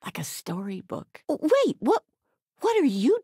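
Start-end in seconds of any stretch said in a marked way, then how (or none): 1.3-3.2 startled. Acted. Mother suddenly surprised.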